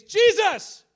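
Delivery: high, loud cry of alarm mid-crash; panicked cry. Retold or imitated. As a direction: yell the name in sudden fright. Crashing into the ditch.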